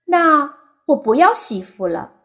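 put-on high, loud little boy's voice; a small boy blurting out his answer, high and loud; childish voice. A narrator's reading. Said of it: "小小的百万福说".

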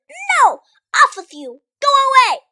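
put-on wicked witch screeching at the children; witch shouting. A narrator's reading. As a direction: bark them away from the gate like a wicked witch. Wicked witch turning Dorothy away.

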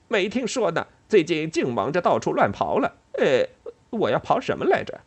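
squeaky, muddled squirrel; flustered squirrel. Acted. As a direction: fret like a forgetful squirrel. Squirrel's dialogue in story.

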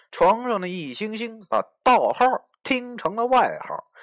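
ordinary delivery

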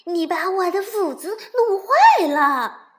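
loud complaining voice; grumbling. A narrator's reading. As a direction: grumble in blame. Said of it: "发了句牢骚".

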